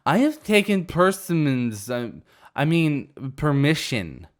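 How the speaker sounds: slurred "persimmons" then corrected to "permission"; drunken slip. Acted. Drunk girl fumbling "persimmons" for "permission". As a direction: trip over "permission" like a drunk.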